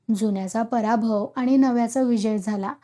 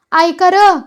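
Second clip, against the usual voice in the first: loud, high call for attention; 6 semitones higher, 9 dB louder, much slower than usual. A narrator's reading. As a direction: call the crowd to listen. Goddess calls crowd to listen. (मोठ्याने हाक मारत)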